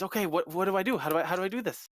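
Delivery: put-on eager young daughter. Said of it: "she says".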